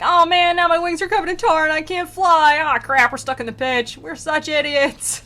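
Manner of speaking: high, loud cartoon lament; mock whining. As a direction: wail in hammed-up self-pity. Acted demons stuck in tar.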